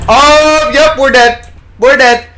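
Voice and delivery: frightened outburst; panicked yelp. Real, not acted. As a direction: blurt out the doom. Caught by monster in game.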